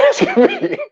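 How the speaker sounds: high-pitched laugh breaking into the words; can barely get it out for laughing; laughing in disbelief. Real, not acted. Dad telling co-host about son's last-minute request, laughing in disbelief.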